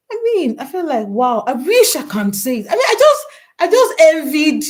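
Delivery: loud, high-pitched burst of envy; exclaimed envy. Real, not acted. Host confessing envy of a friend's free time, exclaimed loudly.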